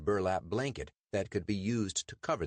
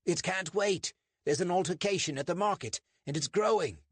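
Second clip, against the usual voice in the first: urgent officer; 12 semitones higher, 4 dB louder than usual. Acted. Urgent officer reports trouble.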